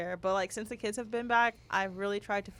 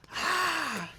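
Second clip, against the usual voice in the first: a short high mock yell, higher; imitated toddler yell; 7 semitones higher, 3 dB louder than usual. Mother retelling her son's shout, mock high cry. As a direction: mom mimics her two-year-old's yell, higher. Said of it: "He lets out a yell".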